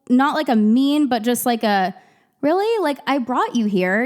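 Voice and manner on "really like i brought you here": mildly annoyed. Retold or imitated. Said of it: "almost annoyed".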